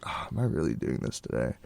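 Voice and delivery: very low, soft groan to self; muttered dismay. Retold or imitated. Student halfway to work in the cold, muttering in dismay. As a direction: sink into a low, weary mutter.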